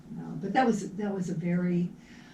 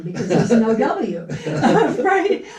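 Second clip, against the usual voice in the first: laughing; 3 semitones higher, 10 dB louder than usual. Real, not acted. Laughing about the missing W.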